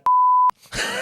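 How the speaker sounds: high shrieking burst of laughter; loud laugh. Real, not acted. Teller cracking up at his mate's insult, shrieking laugh.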